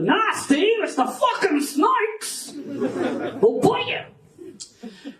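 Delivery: high, drawn-out Australian mock yelp; Australian accent. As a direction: cry out a warning in hammed-up Australian. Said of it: "you hear one say to the other".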